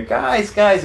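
imitating a friendly shout; calling out. Ronnie calls them over.

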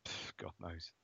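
a breathy whisper, almost under the breath; whispered shrug. Real, not acted. Stumped by the stat quiz, whispering to himself.

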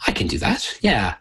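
usual speaking voice

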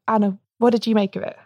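ordinary delivery

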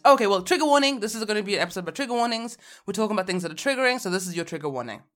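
fast, hurried warning; rushed trigger warning. Acted. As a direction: blurt it out fast like a fight. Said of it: "like you're fighting with someone".

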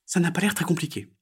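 high frog character voice; little frog. Acted. Frog to herself after watching, high character voice. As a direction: pitch it up as a small frog.

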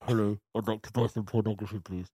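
muffled voice through a cloth; gagged. Retold or imitated. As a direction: talk as if a cloth is in your mouth. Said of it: "disguised my voice with a cloth in my mouth".

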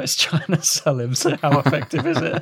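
amused, giggly delivery; laughing. Real, not acted. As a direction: talk through real amusement. Amused by the comedian's anecdote.

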